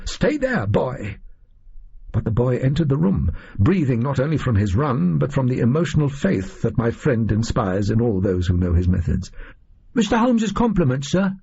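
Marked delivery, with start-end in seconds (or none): 0.1-1.2 mild high-pitched bellow of warning. A narrator's reading. 9.9-11.4 put-on much higher boy's voice, eager and polite; high eager boy messenger; young page boy. A narrator's reading. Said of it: "he said".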